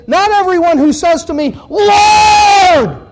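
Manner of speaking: high, loud pulpit shout; impassioned, full-voiced appeal; emotional cry. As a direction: cry out LORD, long and drawn out. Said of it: "really says it with a lot of emotion".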